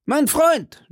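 high-pitched joyful cry; high, urgent call; shouting. A narrator's reading. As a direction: cry out to your friend, pitch right up. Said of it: "schrie D'Artagnan".

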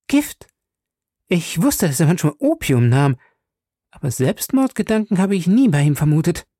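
a sharp high jump on Gift, then quick; Betty shocked by the poison news; startled young lady. A narrator's reading. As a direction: gasp out the word in surprise.

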